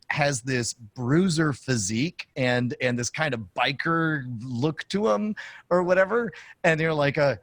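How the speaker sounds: usual speaking voice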